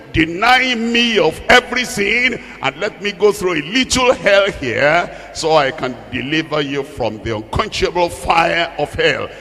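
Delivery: usual speaking voice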